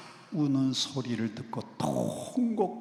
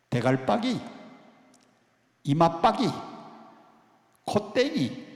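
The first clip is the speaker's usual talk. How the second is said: Gyeongsang dialect words; 4 semitones higher than usual. Retold or imitated. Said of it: "경상도 사람들은요".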